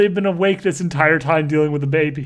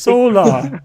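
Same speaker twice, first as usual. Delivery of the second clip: mysterious voice; 2 semitones higher, 5 dB louder than usual. Acted. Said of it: "A voice said".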